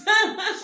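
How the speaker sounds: loud high laugh; real delighted laugh; burst of laughter. Real, not acted. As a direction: let out a sharp laugh. Laugh at good news.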